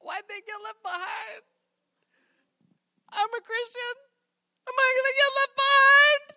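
put-on child afraid of being left behind; scared little kid. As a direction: sound like a frightened kid. Child fearing rapture.

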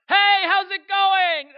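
booming, high, drawn-out hello; shouted, stretched-out cheery greeting; oblivious loud greeting. Acted. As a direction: burst in loudly like a clueless friend. Loud friend arriving at kids' bedtime.

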